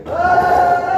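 high shouted "Ah!" like a cheering student; mock pep rally yell. Preacher to congregation, imitating the pep rally shout. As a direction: throw out a quick rally whoop. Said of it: "we'd do".

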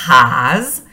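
teacher presenting "ház"; Hungarian word. Shown as a demonstration. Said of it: "tengo la palabra base".

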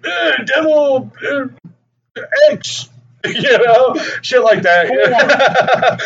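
high, slow dim-witted mock scrawl voice; mock Ronnie. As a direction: drag it out like a clueless boy. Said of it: "he was like".

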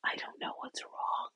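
slow, breathy whispered admission; helpless whisper. Retold or imitated. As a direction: let it slip out as a lost whisper. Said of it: "It's like".

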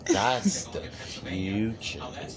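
slow soft stretched future. Shown as a demonstration. Host demoing the stretched word future. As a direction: let future drag out long. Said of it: "Stretch out the future".

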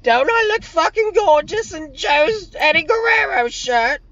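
very high falsetto, far from own voice; exaggerated high-pitched parody of a girlfriend; mock Charlie voice. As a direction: preen in a squeaky girly voice. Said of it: "Charlie chirping in the background".

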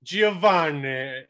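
high, drawn-out mock Italian accent; exaggerated Italian accent. Mimicking the chef saying his surname, exaggerated Italian accent. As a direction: stretch the name with an extra-heavy Italian accent. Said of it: "he puts an extra accent on that".

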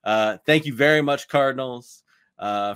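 ordinary talk

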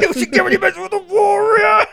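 shouted high through laughter, hamming it up; laughing mock warrior shout. Friends reenacting Carla's arm-wrestling taunt, laughing mock shout. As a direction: yell it like a tiny fierce warrior, cracking up.

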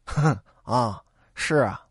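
low, cold sneering laugh. Acted. Boatman coldly sneering back at the shore men. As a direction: scoff at the threat. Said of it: "冷笑几声".

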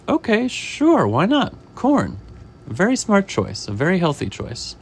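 storybook chef humouring a customer; approving chef. Acted. Chef taking a chicken's order for corn, acted approval.

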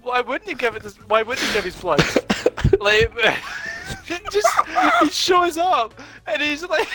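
high, squealing laugh-talk that keeps cracking into giggles; laughing so hard it turns into tears; real tears-of-laughter telling; helpless laughter. Real, not acted. Helpless laughter recounting movie.

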